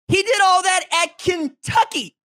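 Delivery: high, loud and slow, hammering each word; incredulous shout. Real, not acted. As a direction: yell it like nobody would believe it. Emphatic disbelief at coach stat.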